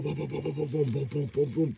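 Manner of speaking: rapid spoken booms like grenades going off; a string of quick pretend blasts; mock explosions.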